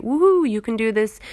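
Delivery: loud, high pumped-up whoop; rally cheer. Retold or imitated. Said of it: "We're at the rally".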